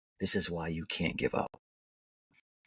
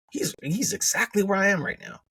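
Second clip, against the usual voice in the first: much higher, the thought bursting out; excited realization; 11 semitones higher than usual. Retold or imitated. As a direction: blurt it as it suddenly clicks. Audience member's realization continues.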